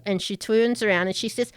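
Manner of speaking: normal talking voice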